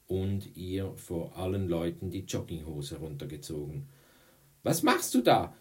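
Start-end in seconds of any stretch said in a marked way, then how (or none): 4.6-5.5 loud, high outraged scream. A narrator's reading. Girl to boy caught in her skirt, screaming. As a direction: scream the question at him. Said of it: "schrie Margaret".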